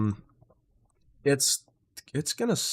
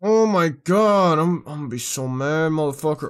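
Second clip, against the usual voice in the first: higher, louder frustrated venting; real anger; 4 semitones higher, 6 dB louder than usual. Real, not acted. Streamer venting to chat about OBS audio, loud angry rant. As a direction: boil over at the screen. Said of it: "I'm so mad right now".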